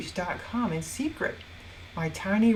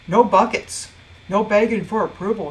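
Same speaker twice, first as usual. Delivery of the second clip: ordinary delivery, nothing marked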